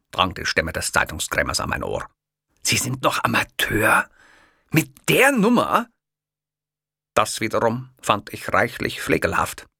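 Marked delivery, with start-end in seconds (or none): 2.6-5.9 rising, higher, louder disbelief; can't believe he's an amateur; incredulous kiosk man. A narrator's reading. Kiosk man doubting the stranger, rising astonishment.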